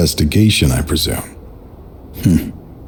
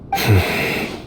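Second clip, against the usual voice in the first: quiet hopeless exhale; soft sigh of despair; 6 dB quieter than usual. Acted. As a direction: breathe out the grief. Man without will to live.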